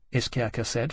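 ordinary delivery, nothing marked

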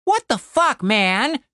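high-pitched outcry; exasperated cry. A narrator's reading. Tim snaps back at Cooper's blame, crying out. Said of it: "cried Tim".

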